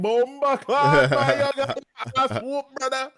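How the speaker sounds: high, loud Jamaican trash talk; Jamaican patois accent. Retold or imitated. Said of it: "talking in my Jamaican accent".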